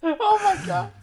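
high helpless laughter; gasping out words between laughs; laughing fit. Real, not acted.

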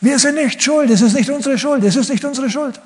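shouting. Acted. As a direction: yell out your innocence in panic. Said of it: "sie brüllen ganz laut".

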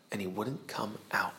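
hushed storyteller whisper; teacher building suspense about the hidden captain. A narrator's reading. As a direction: lean in and whisper the secret.

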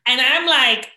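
usual speaking voice

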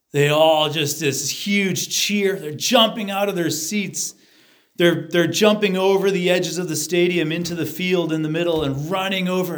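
high, swelling, thrilled storytelling; excited. Real, not acted. Speaker describes the stadium crowd jumping out of their seats, excited. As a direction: raise the voice as if the crowd is erupting.

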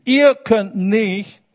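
Ihr könnt nicht said slow and loud; slow preaching emphasis; emphatic, slow. Acted. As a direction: hammer every word home. Preacher hammers Jesus's words.

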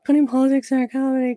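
mock whiny fan. Parroting angry fans to mock them.

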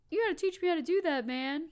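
excited, high, loud cartoon-pal quote; Skeeter. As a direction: blurt it high and loud, imitating an awed buddy. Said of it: "I love that Skeeter was like".

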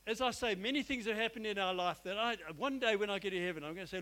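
ordinary talk, nothing marked

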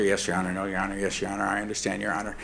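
low, soft, submissive yes-your-honors; dutiful and quiet, fading away; meek deference. Retold or imitated. Father humbly answering the judge, low and soft.

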